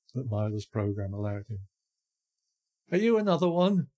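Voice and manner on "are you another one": put-on high, loud, quavering old woman. A narrator's reading. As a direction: call out shakily from the porch like an old woman. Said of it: "a quavering voice called out".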